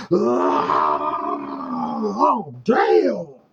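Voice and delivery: high yelping cry rising loud; mock scream. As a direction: yelp it like you got hit. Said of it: "Next thing you know".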